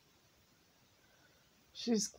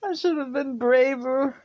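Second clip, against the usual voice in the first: high, loud, aching with regret; pushing through tears; upset; 8 semitones higher, 8 dB louder than usual. Real, not acted. Grieving over giving dog back.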